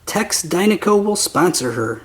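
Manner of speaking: plain reading voice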